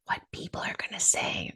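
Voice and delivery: hushed stage whisper. Acted. Host confiding in listeners about IEP meetings, whispered. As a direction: lean in and whisper the warning.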